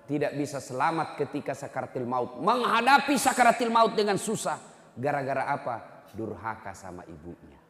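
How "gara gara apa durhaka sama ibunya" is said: ordinary talk, nothing marked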